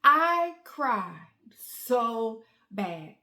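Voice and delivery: CRIED SO BAD very slow, each word apart; slow, drawn-out stress on "so bad"; emphatic. Real, not acted. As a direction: stretch "cried", then pause before "so" and "bad". Stressing how hard she cried.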